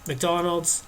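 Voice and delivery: ordinary delivery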